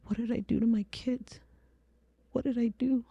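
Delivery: high, softened voice of regret; reenacted stricken self-questioning; anguished remorse. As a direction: ask it small and high, full of guilt. Remorse over her kids, retold.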